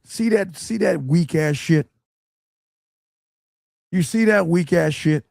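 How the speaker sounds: high, incredulous scorn at the text; scornful disbelief. Real, not acted. Coach scoffing at a student's text, high and scornful. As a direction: jeer at how weak it is.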